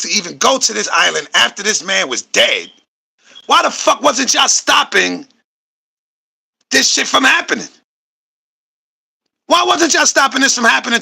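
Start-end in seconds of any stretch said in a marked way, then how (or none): 3.5-11.0 outraged. Real, not acted. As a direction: press the question hard, outraged. Outraged reaction to the clip.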